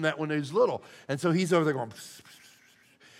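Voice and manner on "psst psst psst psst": imitating a child's unvoiced whisper; mock whispered psst sounds. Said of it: "he starts to whisper".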